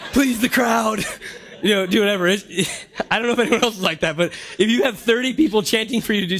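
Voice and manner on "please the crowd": laughing, high and pleased. Retold or imitated. Preacher laughing as he caves to the chanting crowd. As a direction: giggle it out, caving to the crowd. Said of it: "I'll just be like".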